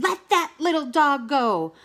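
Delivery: screaming. Acted. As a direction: Cow yelling at Wolf to free Dog. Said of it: "Cow screamed".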